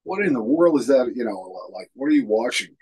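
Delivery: loud, high disbelief; loud shocked question; retold exclamation. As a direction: demand it like she can't believe it. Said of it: "she's like".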